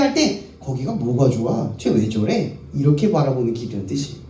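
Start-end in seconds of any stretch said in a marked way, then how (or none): 0.6-2.6 scoffing onlookers. Retold or imitated. Voicing mocking onlookers.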